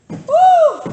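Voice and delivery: loud high whoop; excited whoop. Real, not acted. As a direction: cheer the class on. Cheering mid workout.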